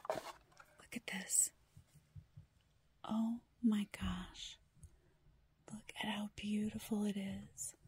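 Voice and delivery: slow, soft awed whisper; admiring the pen in a whisper; hushed whisper. Real, not acted. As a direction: whisper it in wonder.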